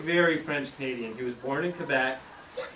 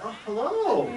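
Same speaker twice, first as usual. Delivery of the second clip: high, singsong mother voice; mother answering phone; 8 semitones higher than usual. Retold or imitated. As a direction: pick up the phone as a cheery mother. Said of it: "My mother answered the phone".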